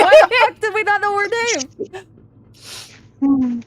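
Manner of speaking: loud, fast, laughing disbelief; laughing outburst. Real, not acted. Player laughing at the forgotten name, loud and fast. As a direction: blurt it out mid-laugh.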